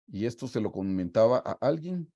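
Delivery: ordinary delivery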